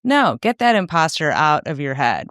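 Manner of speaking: ordinary talk, nothing marked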